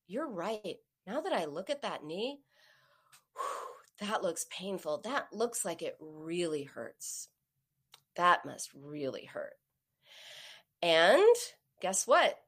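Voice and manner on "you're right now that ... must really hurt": softer, lower, slowing sympathy; validating. Retold or imitated. Mom acknowledging her daughter's skinned knee, validating. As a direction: take the hurt seriously, gently and slowly. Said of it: "As soon as I acknowledged and validated".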